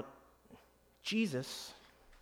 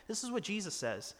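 ordinary delivery

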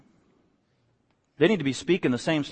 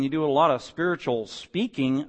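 usual speaking voice

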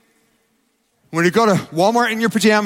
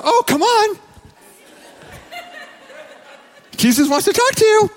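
mock sweet crowd; 13 semitones higher than usual. Mocking fickle crowd, high voice.

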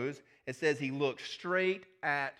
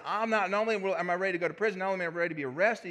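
eager boast; 5 semitones higher, 5 dB louder, faster than usual. Acted. Peter boasts loyalty.

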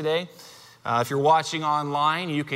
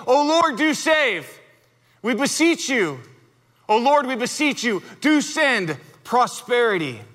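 raised, ringing proclamation of the prayer; proclaiming; 5 semitones higher than usual. Retold or imitated. Preacher voicing the crowd crying out to the Lord, raised proclamation. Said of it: "they're proclaiming".

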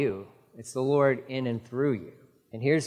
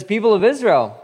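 raised preaching voice to the crowd; loud public appeal; 6 semitones higher, 6 dB louder than usual. Acted. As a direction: call out to the people of Israel, louder. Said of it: "He says".

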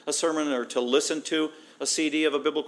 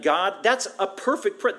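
ordinary talk

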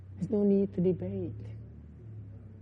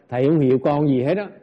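loud, fast complaining disciple; mock aggrieved student; 8 dB louder, much faster than usual. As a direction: whine at the master, fast and loud. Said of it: "Người ta nói là".

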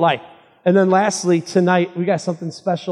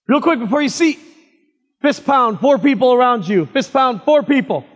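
calling out; 6 semitones higher, 4 dB louder than usual. Real, not acted. Calling congregation to greet.

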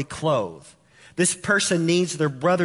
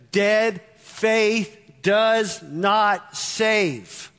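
very slow emphasis; 4 semitones higher, much slower than usual. Acted. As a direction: drag out each word of dead faith does not save. Preacher hammers key phrase.

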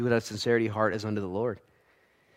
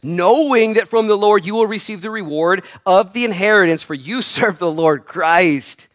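verse read loud and high, slowing at the end; proclaimed verse; 9 semitones higher, 11 dB louder, slower than usual. Shown as a demonstration. Preacher urging listeners to get the verse, loud proclamation. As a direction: proclaim the promise loudly. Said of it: "I want you to get this".

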